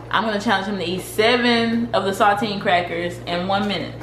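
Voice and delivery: ordinary talk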